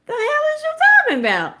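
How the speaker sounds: high, fast mock voice of a confused guy; puzzled retort. Retelling to viewers, mimicking the puzzled man's high fast retort. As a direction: squeak it out fast, baffled.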